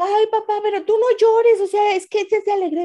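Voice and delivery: high, loud, quickening reassurance; urgent protest. Retold or imitated. Daughter telling her crying father it is from joy, urgent protest. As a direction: re-enact an exclaimed plea to dad.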